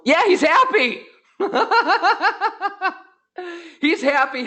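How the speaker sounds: high laugh through the words; laughing with relief. Real, not acted. Host relieved the character is happy again, laughing high. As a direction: giggle it out, relieved.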